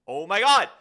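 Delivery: very loud, high-pitched cry; exasperated outburst. Real, not acted. Streamer fighting with the snapping, exasperated outburst. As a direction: burst out at full volume. Outburst while fiddling with controls.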